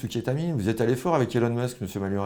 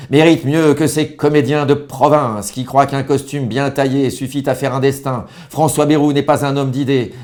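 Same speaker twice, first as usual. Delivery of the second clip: slow, loud senate-floor declamation; orator's punchline; 4 semitones higher, 10 dB louder than usual. Acted. Senator reading his attack speech on Bayrou, slow and loud. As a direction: hold the tribune and hammer each word slowly. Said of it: "Je me chauffe".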